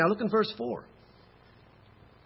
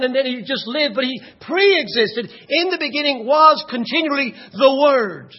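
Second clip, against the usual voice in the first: loud, higher, emphatic preacher's voice; full-force preaching; 7 semitones higher, 9 dB louder than usual. Acted. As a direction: drive each phrase home to the congregation.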